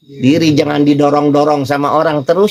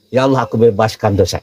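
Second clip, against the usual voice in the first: forgiving prayer; 7 semitones lower than usual. Shown as a demonstration. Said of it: "Enak gak gitu?".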